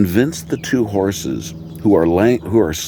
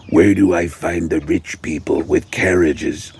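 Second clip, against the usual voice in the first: slow, lightly put-on vampire voice; a hint of a stately vampire, slowly; vampire asking. Vampire asking a local the way, lightly put-on slow voice.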